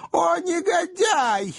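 high, shrill-voiced outraged old governess crying out; shrieking old governess. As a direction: squeal it like a scandalised old spinster. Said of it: "воскликнула самая старая и безобразная воспитательница". (визгливый возглас старой воспитательницы)

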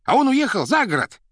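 high, loud, agitated cry; excited shouting. A narrator's reading. Administrator telling the director it's not Yalta, shouting excitedly. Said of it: "возбуждённо кричал администратор".

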